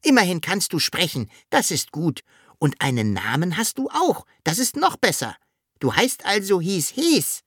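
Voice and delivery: high-pitched, lively Sams voice; Sams, cheeky little creature. Acted. Sams greeting the talking dragon, high pert voice.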